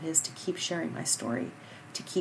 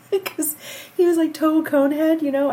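laughing; 10 semitones higher, 8 dB louder than usual. Real, not acted. Laughing at baby's conehead.

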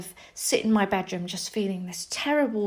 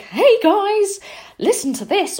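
much higher, louder call to the class; attention-grabbing call; 12 semitones higher, 7 dB louder than usual. Retold or imitated. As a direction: grab the whole room's attention. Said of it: "or do something silly".

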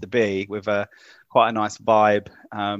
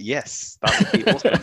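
retold eager kid's yes, leaping up on awesome; a mock-excited childhood yes; 5 semitones higher than usual. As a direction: replay your young self thrilled at the offer. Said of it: "I was like".

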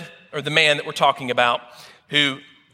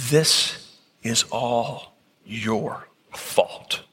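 tearful accusation; 4 semitones lower, much slower than usual. Acted. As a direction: let the blame come out slowly through tears. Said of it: "And through the tears".

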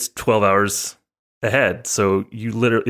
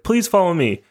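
high-pitched mock Japanese accent; imitated Japanese accent; 9 semitones higher than usual. Airline ladies lead passenger away, imitated Japanese accent. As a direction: usher someone along in a put-on Japanese accent. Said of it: "kinda what they sounded like".

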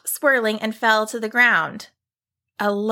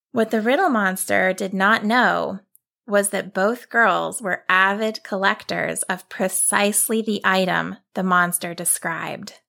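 plain reading voice

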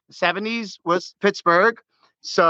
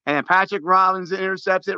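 ordinary talk

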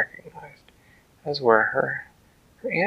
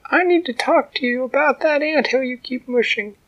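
tiny ant voice; 13 semitones higher than usual. A narrator's reading. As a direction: speak up boldly as the tiny ant.

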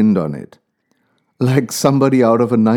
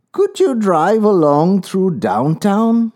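higher, slow frail lady voice; old woman voice; 7 semitones higher, slower than usual. A narrator's reading. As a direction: ask gently like an old lady. Old woman asks route.

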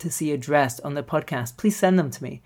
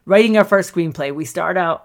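normal talking voice

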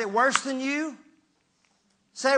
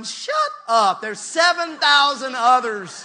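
slap the whining down; a loud scolding retort; blunt shout; 2 semitones higher, 4 dB louder, slower than usual. Acted. God's retort to Elijah.

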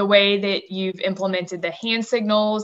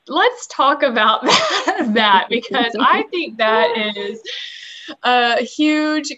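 laughing; 5 semitones higher, 4 dB louder, slower than usual. Real, not acted. Laughing at the topic.

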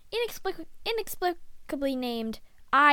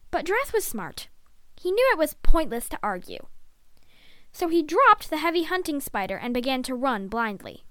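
ordinary delivery

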